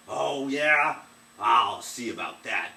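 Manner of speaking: loud, slow threatening growl; angry giant. Acted. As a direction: challenge her, loud and menacing.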